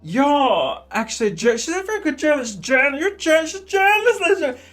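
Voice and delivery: high, stumbling mock announcement; impersonated TV host. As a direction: trip over the words in a high put-on voice. Parody of [name]'s stammered announcement.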